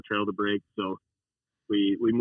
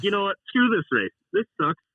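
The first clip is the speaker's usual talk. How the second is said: higher, exasperated retold complaint; fed-up outburst; 9 semitones higher, 4 dB louder than usual. Racer quitting after hitting a tree, mock fed-up whine. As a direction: throw in the towel, voice raised in pitch.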